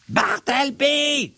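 very high, drawn-out yell of Bartleby; shouting a name. Acted. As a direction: call the name out as a furious summons. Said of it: "vociférai-je".

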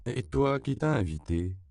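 high, loud start, then dropping; mock questioning of an uninvited guest; teasing challenge. A narrator's reading. Surgeon addresses the little gecko.

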